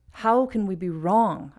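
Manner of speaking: "How" and "wrong" punched; stress first and last. Shown as a demonstration. Teacher marking natural emphasis, stress on "How" and "wrong". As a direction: hit "How" and "wrong", skim the middle. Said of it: "the first and the last in every case".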